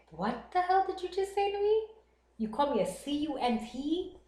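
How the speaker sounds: hammed-up offended comeback; retold livid. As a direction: replay being livid, half laughing by the end. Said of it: "fucking livid".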